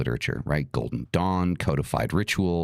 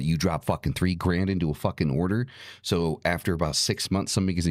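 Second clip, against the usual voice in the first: ordinary delivery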